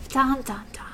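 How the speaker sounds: hushed "dun, dun, dun"; mock suspense sting. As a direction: whisper a dramatic reveal sting. Mock suspense sting after villain's name.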